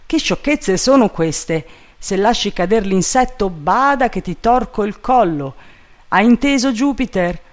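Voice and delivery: high, loud threatening master; scolding master Legrand. A narrator's reading. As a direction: scold someone up a tree.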